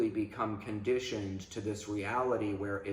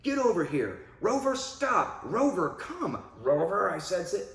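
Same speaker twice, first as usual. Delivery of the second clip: imitating a frustrated owner, higher and strained; 9 semitones higher, 4 dB louder than usual. A frustrated owner yelling at Rover, mock exasperated commands. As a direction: nag the dog in exasperation. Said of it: "so used to being frustrated".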